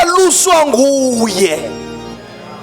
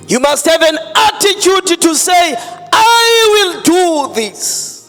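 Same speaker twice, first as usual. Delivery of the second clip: rising to a tearful shout; impassioned preaching shout; 4 semitones higher than usual. Real, not acted. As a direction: cry out the declaration. Preaching climax, shouting, crying.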